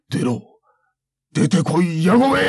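building to a very high loud yell; angry shout. Acted. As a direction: roar the challenge. Samurai challenges hidden foe.

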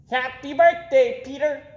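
high, loud squawky parrot repeating. A narrator's reading. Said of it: "repeated the parrot".

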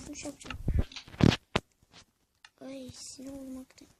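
ordinary delivery

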